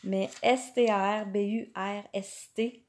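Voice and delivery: "S T A R B U R S T" spelled out as French letter names, one by one. Shown as a demonstration. Host spelling "S T A R B U R S T" for viewers searching Pinterest, letter by letter. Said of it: "pas de la bonne façon qu'on le prononce".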